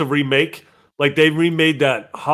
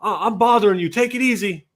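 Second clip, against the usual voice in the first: much higher, louder mock stranger voice; imitating the stranger; 9 semitones higher, 4 dB louder than usual. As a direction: play the stranger backing off, high and loud. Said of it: "He's like".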